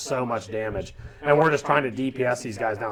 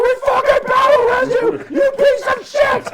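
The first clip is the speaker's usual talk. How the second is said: very high, loud shouted fury; retold enraged yell; 6 dB louder than usual. Raid group screaming at the teammate who went for a sandwich, furious yell. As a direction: scream it with everything. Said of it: "all nine of us are just screaming at him".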